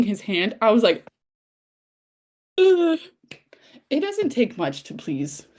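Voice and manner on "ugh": high-pitched fangirl ugh; swooning groan. Retold or imitated. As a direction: groan high, overcome by the romance. Retells swooning at hand scene.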